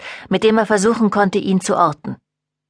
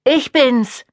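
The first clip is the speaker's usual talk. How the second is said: shouted; 6 semitones higher than usual. A narrator's reading. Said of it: "brüllte er".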